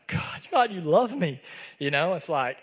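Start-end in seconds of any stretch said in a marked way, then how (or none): 0.1-1.4 high, lightly tearful cry to God; a touch of remembered tears, voice lifting; retold weeping. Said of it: "And I just begin to weep".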